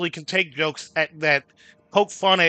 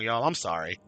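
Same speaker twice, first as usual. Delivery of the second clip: ordinary talk, nothing marked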